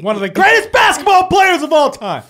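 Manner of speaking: high, loud indignant bellow; mock-outraged shout. Mock outrage over a mistaken name.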